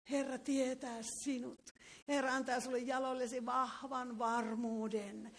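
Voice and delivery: ordinary talk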